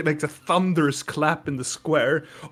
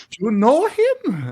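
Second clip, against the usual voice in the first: put-on higher, slow girlish voice; Siren voice; 8 semitones higher, 3 dB louder than usual. Siren asking about the Minotaur, high and light. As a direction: ask it sweetly up high. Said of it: "Siren looks like".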